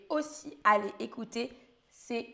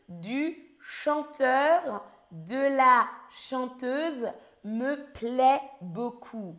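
very slow quiz read; much slower than usual. Shown as a demonstration. Teacher reading the fill-in sentence to the class, very slow. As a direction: stretch every word slowly. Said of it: "teacher reads gapped quiz sentence".

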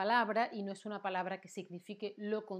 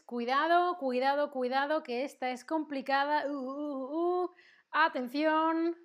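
higher, louder playful alarm; mock warning; 5 semitones higher, 6 dB louder than usual. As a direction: sound a teasing alert for a tricky question. Mock warning before a tricky question.

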